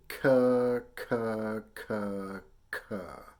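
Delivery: four separate "ka" syllables, stepping down from a loud start; K-tongue syllables. Shown as a demonstration. Teacher introducing the K sound, back-of-tongue "ka" drill. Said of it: "we're gonna say".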